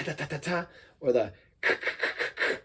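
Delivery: short tongue-clicked snare hits; beatboxed snare. Shown as a demonstration. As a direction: tap out the snare sound. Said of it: "That's like the ta".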